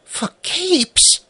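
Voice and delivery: put-on very high, delighted child; overjoyed. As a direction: gasp it out with joy.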